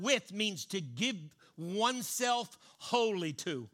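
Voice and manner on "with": "with" set apart, higher and louder; stress on with. Shown as a demonstration. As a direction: hit "with" alone and hard. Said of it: "And that word".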